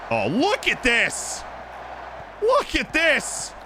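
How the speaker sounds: slow, high-pitched amazement; drawn-out, awed fan cry; marvelling. Real, not acted. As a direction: stretch the words out in rising wonder. Marvelling at the passing move.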